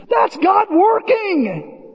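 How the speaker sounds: high, slow shouted declaration; ringing answer from the pulpit; emphatic shout. Acted. Preacher's emphatic correction.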